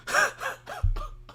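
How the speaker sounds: a high, breathy burst of giggling; high breathy laughing burst. Real, not acted. Host to cohosts, losing it, breathy high giggles.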